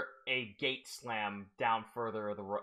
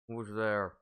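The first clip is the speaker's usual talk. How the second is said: muffled voice; 4 semitones lower than usual. Acted. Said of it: "a muffled voice".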